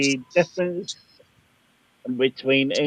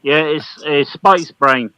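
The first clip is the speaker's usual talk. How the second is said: ordinary talk, nothing marked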